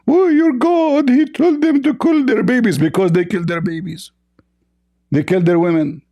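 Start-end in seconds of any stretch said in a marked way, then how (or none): 0.1-2.8 hammed-up impression of the other debater; mocking imitation. Mocking the opponent's claim.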